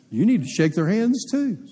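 high, pushy retort; mock bossy comeback. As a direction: toss the order right back at him.

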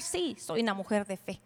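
low, soft recital of a confession; lip service. Acted. Woman professing faith out of habit, mere lip service. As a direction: say it quietly, just going through the words. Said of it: "no solo lo digas de dientes para afuera".

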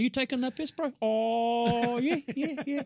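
high-pitched Maori English banter; Maori accent. Acted. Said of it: "got my Maori accent down".

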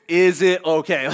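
mock mad. Dad getting mock mad at his permissive daughter. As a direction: push it into fake outrage. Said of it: "and I get kinda mad".

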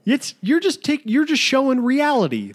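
high-pitched baffled objection; indignant onlooker. Acted. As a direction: lecture the photographer in mock exasperation. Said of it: "People were obviously like".

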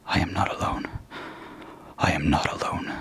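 soft unvoiced whisper; whispering to himself. Emery steadying himself among the goblins, whispered. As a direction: whisper it as a frightened prayer. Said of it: "Emery whispering to himself".

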